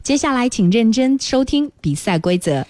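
usual speaking voice

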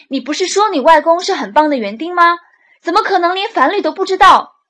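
high, loud accusing shout; outraged disbelief; angry. Acted. Furious boss accusing the boy.

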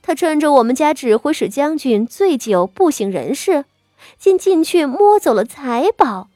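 a cold sneer dressed as sympathy. Acted. Concubine Xue mocks the wife over her daughter, sneering. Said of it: "薛姨娘冷笑着".

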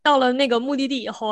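usual speaking voice

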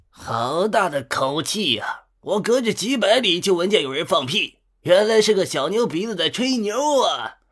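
put-on high, cackling old prankster; mocking voice. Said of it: "一个带着嘲讽的声音".

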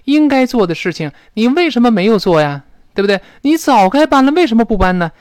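reproachful. Shown as a demonstration. Teacher demonstrating blame about a past failure, reproachful. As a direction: demand to know why it was never done. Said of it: "一种责备的语气".

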